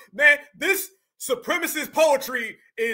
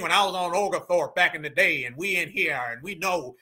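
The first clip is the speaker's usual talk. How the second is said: mock Southern old-timer; 6 semitones lower than usual. Reactor mocking Southerners' long stories, low mock old-timer. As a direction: drop your voice and parody a Southerner reminiscing. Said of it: "he'll go down this whole thing".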